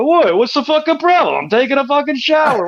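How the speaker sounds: high, indignant New York protest; New York accent. Retold or imitated. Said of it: "you got that New York accent, like, thick".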